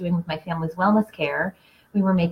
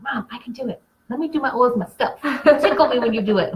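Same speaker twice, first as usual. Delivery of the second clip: put-on light, higher little-boy voice, eager; imitating her son; 6 semitones higher than usual. Mom retelling her son begging to do his oils, lightly higher kid voice. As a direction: play the five-year-old asking to do it himself. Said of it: "He was like".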